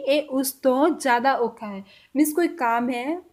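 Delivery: ordinary delivery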